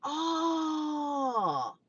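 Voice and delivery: usual speaking voice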